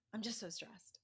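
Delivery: whispered, imitating the stressed butcher. Butcher overheard fretting to himself, whispering. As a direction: breathe it out as a worn-out whisper. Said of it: "I overheard him saying".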